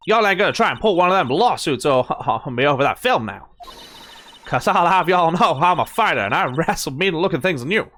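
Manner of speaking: hammed-up Southern fighter; butchered Southern accent. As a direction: threaten in a badly done Southern accent. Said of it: "I'm butchering this accent".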